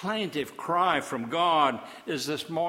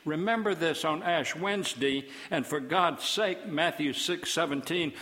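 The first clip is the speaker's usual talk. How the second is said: usual speaking voice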